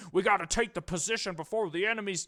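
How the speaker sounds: lightly voiced rugged soldier; a hint of a military guy. Shown as a demonstration. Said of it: "if he's got a rugged military voice".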